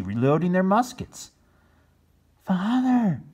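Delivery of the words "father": high urgent call; boy's voice. Acted. Chris alerting his father, urgent. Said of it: "said Chris".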